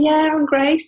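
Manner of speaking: high, loud, bright-faced insistence over tears; pretending to be fine while crying, loud and high; forced cheer. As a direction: brush it off with a big fake smile. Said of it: "And I kept saying".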